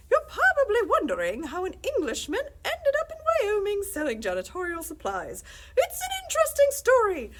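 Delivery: a bright English salesman on the phone; chipper English accent. Acted. English window-cleaner salesman calls the principal, chipper English accent. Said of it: "somebody with a chipper English accent".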